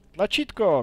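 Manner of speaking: bursting out loud and high; sudden excited cry. Real, not acted. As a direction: shout the find with delight. Finds a button in game.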